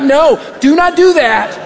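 ordinary talk

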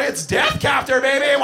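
put-on gleeful kid's high yell; hammed-up high battle cry. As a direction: holler it like a wild child. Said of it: "he yells out".

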